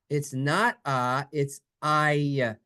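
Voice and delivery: usual speaking voice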